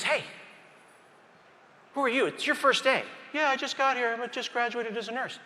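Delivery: lightly voiced nervous rookie, pitched well up. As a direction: play the rookie nurse's anxious answers. Resident questions rookie nurse.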